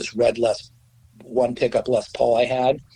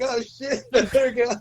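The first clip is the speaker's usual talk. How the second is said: laughing mock alarm; 13 semitones higher than usual. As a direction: replay the caught-out moment while cracking up. Own panicked reaction, told laughing.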